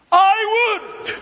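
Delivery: high, loud wailing cry; a sudden anguished outcry from Jesus over Jerusalem. Acted. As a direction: cry it out as the weeping Christ.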